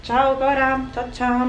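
normal talking voice

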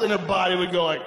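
normal talking voice

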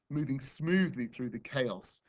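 plain reading voice

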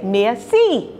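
usual speaking voice

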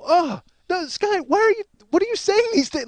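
high-pitched mock dismay; shocked squeal. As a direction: squeak it out in disbelief. Shocked by the boy's words.

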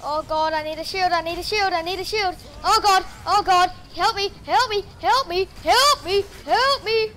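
high, loud frantic yelling, building; in-game panic, rising to shrill-pitched shouts of help; panicked cry for help. Real, not acted. Panics in game under attack.

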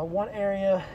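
ordinary talk

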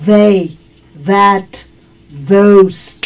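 "They, that, those" with TH like Spanish soft D; soft TH demo. Shown as a demonstration. Teacher shows learners TH in "They, that, those", soft Spanish-D sound. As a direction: isolate "They", "that", "those", voiced TH clearly sounded. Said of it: "suena como la D del español".

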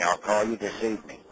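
ordinary talk, nothing marked

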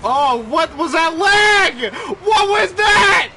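high, slow, drawn-out shout of disbelief; outraged yell. Real, not acted. Gamer yelling at a laggy goal, outraged. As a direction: belt out the outrage at the lag.

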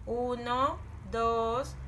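ordinary delivery

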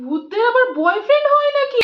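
normal talking voice

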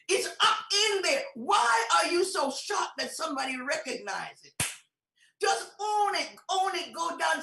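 loud, high, urgent exhortation; full-force preaching. Real, not acted. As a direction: challenge the congregation at full voice.

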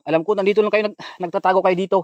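loud call to hidden ones. Real, not acted. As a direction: shout into the dark at those hiding. Calling to hidden ghosts.

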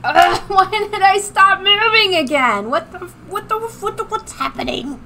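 squeaky, alarmed questions; sudden high-pitched bafflement; high panicked confusion. Real, not acted. Avatar freezes again.

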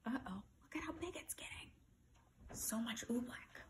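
very soft, whispery aside; whispered little comment on the story; hushed aside. Real, not acted. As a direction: drop to a near-whisper, as if sharing a worry.

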